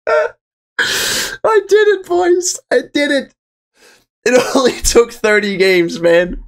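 high, slow, choked words; real happy crying; tearful joy. Real, not acted. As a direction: barely hold it together. Reading result, emotional.

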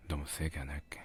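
ordinary talk

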